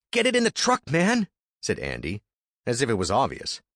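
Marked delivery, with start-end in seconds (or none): 0.1-1.3 higher, pushy younger brother; Andy's voice. Acted. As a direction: urge him on, high and eager.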